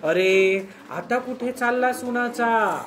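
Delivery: अरे called out, then the question with a sharp questioning tune; scolding mother's question. Shown as a demonstration. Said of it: "प्रश्नार्थक आपलं स्वर".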